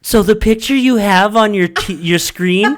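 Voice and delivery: high, loud, amazed question; imitating a thrilled, nosy coworker; excited coworker. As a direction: build to a drawn-out squealed question. Excited coworker acted asking about wife.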